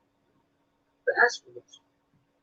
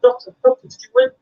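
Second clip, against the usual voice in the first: high, loud mock eagerness; eager relative; 5 dB louder than usual. Relative asks doctor about the outcome, eager high voice. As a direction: pipe up like a hopeful contestant.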